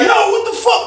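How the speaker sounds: very high retold alarm; startled disbelief. Retold inmates startled by the man.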